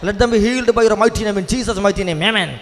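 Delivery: high-pitched, urgent prayer declaration; fervent prayer. Real, not acted. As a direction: pray it out high and call for amens. (ഉയർന്ന സ്വരത്തിൽ തീക്ഷ്ണമായ പ്രാർത്ഥന)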